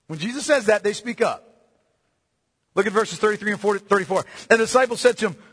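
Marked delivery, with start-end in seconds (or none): none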